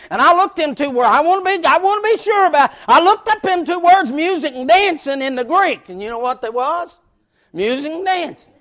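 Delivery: loud, fast preaching; fiery preaching. Acted. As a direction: preach it out to the congregation. Preaching at full force.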